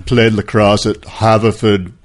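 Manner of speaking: slow, put-on preppy grandeur; mock upper-crust. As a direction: draw it out like a parody blue blood. Continues mock upper-crust introduction.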